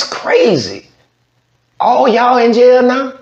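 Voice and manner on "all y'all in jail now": high-pitched, louder disbelief; can't believe they're all locked up; incredulous. Real, not acted. Reacting to whole family jailed.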